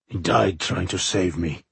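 subdued, understated grief; somber. A narrator's reading. King mourns beside a corpse, somberly. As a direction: say it with a touch of mourning. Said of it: "he said somberly".

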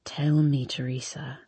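soft unvoiced seductive whisper; cooing whisper. A narrator's reading. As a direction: coo it in a breathy whisper. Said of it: "he coos".

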